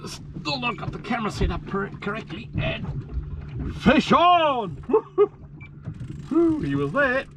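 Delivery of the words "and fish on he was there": high, slow drawn-out call; excited shout. Real, not acted. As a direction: call it out gleefully. Fish hooked again.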